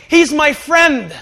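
high, slow, loud proclamation; drawn-out acted announcement; loud vouching. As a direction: call it out across the gate. Acts president vouching from the gate.

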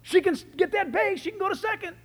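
hopeful sports dad. Retold or imitated. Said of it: "I'm thinking".

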